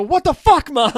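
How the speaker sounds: high, loud exasperated mock yell; hammed-up outrage at mom. Yelling at mom in retold story.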